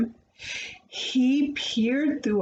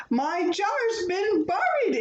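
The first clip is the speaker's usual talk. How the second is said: higher, indignant cry; young bear's heated outburst; hot exclamation; 8 semitones higher than usual. A narrator's reading. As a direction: blurt it out hotly. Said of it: "exclaimed Paddington hotly".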